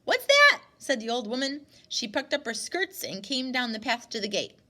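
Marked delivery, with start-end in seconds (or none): 0.0-0.6 loud, much higher demand; sharp call. Acted. Grandmother demanding what the stranger wants, loud call. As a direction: snap the question out loud. Old woman calling, suspicious.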